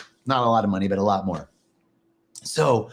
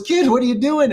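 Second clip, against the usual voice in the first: higher and louder startled call; surprised adult; 11 semitones higher, 5 dB louder than usual. Acted. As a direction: react in shock at the kid rolling in poop. Adult startled by kid in poop.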